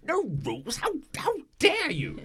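an offended chess piece shrieking in outrage; outraged. Acted. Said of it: "The Emperor goes".

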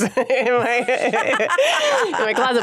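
amused, laughing through the words. Real, not acted. Guest jokes about her unshaved legs, laughing. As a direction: voice rising into a real laugh.

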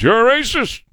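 high mock accusation; mocking accuser. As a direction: sneer it like a smug accuser.

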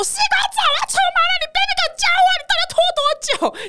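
shrill high scolding yell out the window; yelling at dad. Retold or imitated. Daughter yelling out the window at dawdling dad, high furious scold. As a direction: scold dad, voice cracking up into laughter. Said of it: "然后我就打开窗户就大骂说".

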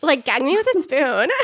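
laughing mock Valley girl line. Cohost teases the guest, laughing mock Valley girl. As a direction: camp up the old Valley girl phrase. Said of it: "talk like a Valley girl".

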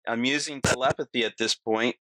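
ordinary talk, nothing marked

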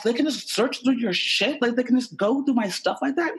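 high, indignant questioning; worked-up, high-voiced incredulity; incredulous. Retold indignant questions about search.

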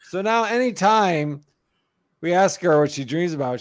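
normal talking voice

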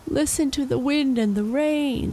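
high, pleading wail. Acted. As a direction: cry out to the storm in despair. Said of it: "the farmer wailed".

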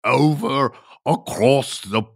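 hammed-up Scottish accent, pitched high and drawn out slowly; a deliberately terrible Scottish accent, stretching the name Liam; bad Scottish accent. Host greets Scottish caller Liam in a mock Scottish accent. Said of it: "That is the worst Scottish accent in the world".

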